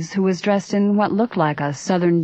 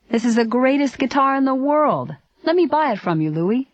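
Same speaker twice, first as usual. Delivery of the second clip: higher, mildly raised narrated shout; excited shout; 8 semitones higher than usual. A narrator's reading. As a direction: call out with pitched-up enthusiasm, not full volume. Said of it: "shouted to Louis".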